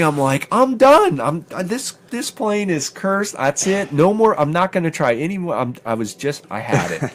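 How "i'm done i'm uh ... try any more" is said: high, exasperated giving up; throwing up his hands at the cursed plane; fed up. Retold or imitated. After the wasted morning, declaring he's done, high and fed up.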